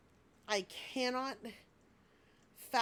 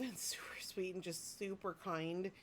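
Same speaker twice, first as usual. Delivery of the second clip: whisper; 6 dB quieter than usual. Real, not acted. Whispered praise of son.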